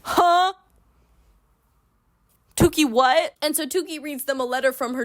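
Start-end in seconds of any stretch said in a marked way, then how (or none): 0.0-3.3 high, loud, baffled outburst; stunned double take; shocked disbelief. Acted. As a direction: blurt it out in high-pitched shock. Shocked reaction after long silence.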